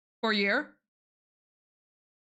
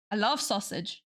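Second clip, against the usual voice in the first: ordinary talk, nothing marked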